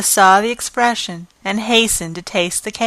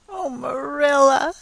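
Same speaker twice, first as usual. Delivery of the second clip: sobbing; 6 semitones higher than usual. Acted. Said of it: "sobbed Anne".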